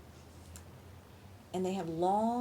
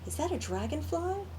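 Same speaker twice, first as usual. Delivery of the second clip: amazed question; 8 semitones higher than usual. Acted. Buddy amazed.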